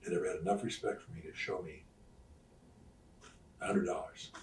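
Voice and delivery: soft, low, understated quote of a moved man; tearful gratitude, retold. Wrestler retelling his manager's thanks, understated tearful gratitude. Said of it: "he cried, he had tears in his eyes".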